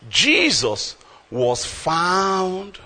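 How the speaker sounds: slow drawn reading. Real, not acted. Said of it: "slow scripture reading".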